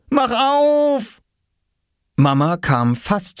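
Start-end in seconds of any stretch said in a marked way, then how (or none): 0.1-1.2 put-on high, urgent boyish yell; desperate cry. A narrator's reading. Oskar to his mother behind the locked door, crying out. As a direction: yell to be let out, high-pitched. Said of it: "schrie er".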